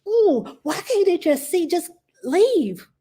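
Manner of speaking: high, pleading cry of frustration; high-pitched distressed venting to herself; upset wail. Retold or imitated. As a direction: whine it out like you're at the end of your rope. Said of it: "I'm like".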